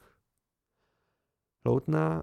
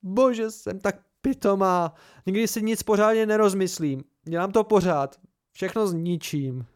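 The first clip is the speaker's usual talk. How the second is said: trembling self-reproach; 6 semitones higher, 4 dB louder than usual. A narrator's reading. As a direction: berate herself, shaking with strain. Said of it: "Celá se skoro třásla napětím".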